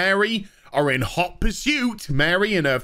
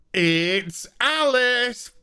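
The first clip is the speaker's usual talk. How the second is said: dramatic reveal; 5 semitones higher, much slower than usual. Acted.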